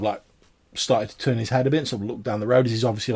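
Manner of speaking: usual speaking voice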